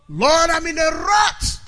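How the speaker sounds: high, loud cry to God; preacher's high-pitched shout; shouted declaration. Shown as a demonstration. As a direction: shout it out at full force from the pulpit. Preacher shouts model declaration to congregation.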